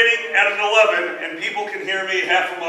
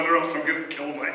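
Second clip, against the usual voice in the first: ordinary talk, nothing marked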